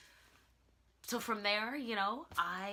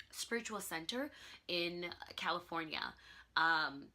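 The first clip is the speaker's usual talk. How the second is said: normal talking voice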